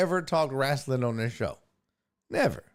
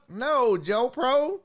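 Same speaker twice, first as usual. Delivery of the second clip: slow teasing scold; 9 semitones higher, 3 dB louder, much slower than usual. Real, not acted.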